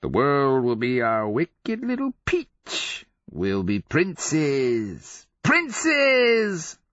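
slow, high crazed croon rising to a squeal; Sarl. A narrator's reading. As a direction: croon like a madman, building to exultation. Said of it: "Sarl crooned to him".

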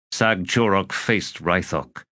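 ordinary delivery

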